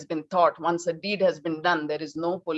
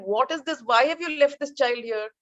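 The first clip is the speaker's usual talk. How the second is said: angry scolding; 7 semitones higher than usual. Retold or imitated. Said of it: "he got so angry with his housekeepers".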